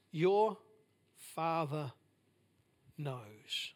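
dropping to a near-hush, slow and gentle; hushed tender. Acted. Preacher softly assuring the church of God's care. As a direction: lay it down softly like a comfort. Tender quoted promise of God.

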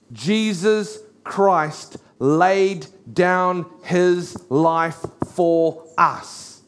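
very slow, word-by-word delivery; slow, solemn emphasis. Retold or imitated. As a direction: let each word land separately as a definition. Preacher delivers the definition word by word.

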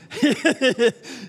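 loud burst of laughter; real laugh. Real, not acted. Preacher laughing at his own joke.

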